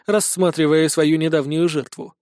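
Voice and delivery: usual speaking voice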